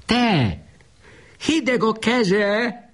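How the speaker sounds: very high, slow, piercing shriek; mother shrieking over her dead child's cold hand. A narrator's reading. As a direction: shriek in horror. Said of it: "most már sivított".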